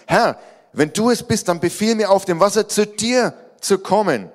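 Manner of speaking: high, loud plea to the Lord; calling out. Acted. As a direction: call boldly across the water. Peter calls to Jesus, read aloud.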